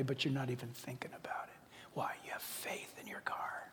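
unvoiced, hushed whisper; dramatic whispered aside. Real, not acted. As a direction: lean in and whisper the point. Preacher drops voice.

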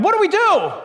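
high, loud cry of alarm; terrified cry. Acted. People lost in the night, terrified cry for direction. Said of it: "when you're terrified".

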